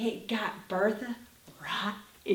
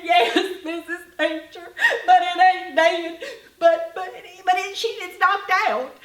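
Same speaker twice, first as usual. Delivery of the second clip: put-on laughing child; 5 semitones higher, 7 dB louder than usual. Child Polly Sue explains, laughing.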